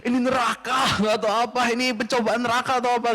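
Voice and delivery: high, put-on whine about the heat; mock groan of a sweating Surabayan; exasperated complaint. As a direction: moan like someone roasting without AC. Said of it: "gitu kan ya".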